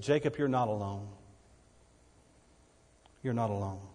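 tender reassurance. Acted. God comforting lonely Jacob.

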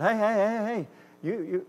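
flag him down from across the room; high calling hey. Retold or imitated. Calling the boy over.

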